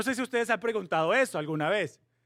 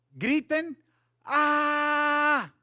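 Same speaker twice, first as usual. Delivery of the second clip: high, held "ah" shout; acted battle cry; 9 semitones higher, 4 dB louder, much slower than usual. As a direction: call out to a whole crowd to yell. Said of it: "tú le vas a decir al pueblo que griten".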